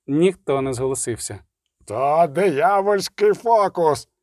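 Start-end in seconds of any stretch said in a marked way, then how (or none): 1.9-4.1 slow, higher-pitched drawn-out howl; howling priest. A narrator's reading. Priest denounces the barber's unburnt hand, howling. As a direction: wail the accusation, stretching the words. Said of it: "завив жрець".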